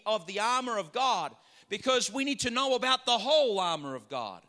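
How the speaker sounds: ordinary talk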